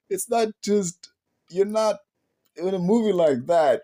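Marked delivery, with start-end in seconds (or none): none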